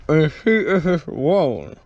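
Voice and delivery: talking around a flashlight; words muffled by mouthful; mouth full. Real, not acted. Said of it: "Sorry, I have a flashlight in my mouth".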